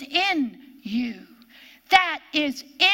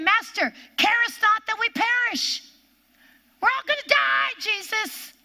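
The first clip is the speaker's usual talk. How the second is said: mock panic; 8 semitones higher than usual. Disciples waking Jesus in the storm, mock panic. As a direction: cry out like men sure they will drown.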